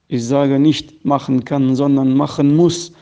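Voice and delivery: ordinary delivery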